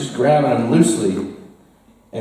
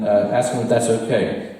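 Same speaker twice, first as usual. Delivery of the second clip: usual speaking voice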